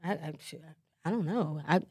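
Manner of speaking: low, soft [name] voice; [name] impression. Asked what [name] sounds like, low and soft impression. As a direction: drop down low and hush it like [name]. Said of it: "What's [name] sound like?".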